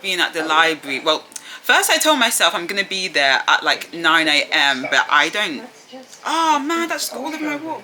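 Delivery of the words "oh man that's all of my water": a yelp at the spilled water; startled oh man. Real, not acted. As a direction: caught off guard by a spill. Spills water bottle mid-sentence.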